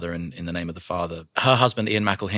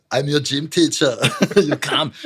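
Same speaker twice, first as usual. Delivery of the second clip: raised, hammed-up character voice; 6 semitones higher, 5 dB louder than usual. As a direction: put on a silly teacher voice. Acting a character voice.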